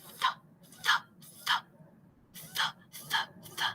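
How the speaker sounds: "th" as short voiceless puffs, tongue at the teeth; soft, isolated "th", repeated with gaps; TH sound demo. Shown as a demonstration. Teacher showing students the TH, each "th" one soft voiceless sound. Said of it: "So it would sound like".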